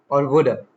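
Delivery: "woulda" ending in a loose "uh"; reduced form. Shown as a demonstration. Teacher showing casual speech, fully reduced. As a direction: drop the V in "woulda". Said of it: "Reduced in casual speech".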